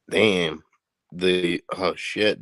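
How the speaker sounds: normal talking voice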